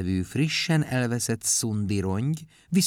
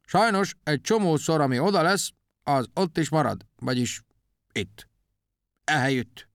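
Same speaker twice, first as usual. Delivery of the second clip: high oddball voice, sinking into halting bits; Kakubak úr; 7 semitones higher than usual. A narrator's reading. Odd thinker explains where lost things stay, quirkily. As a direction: start high and quirky, then drop off word by word. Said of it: "csak egy kicsit furán beszélt".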